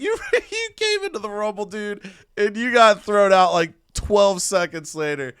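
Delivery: high, teasing laugh breaking into the words; mock your partner through laughter; Batista laughing. Wrestler laughing at teammate's failed Rumble, mocking laughter. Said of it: "Batista is just laughing at A J".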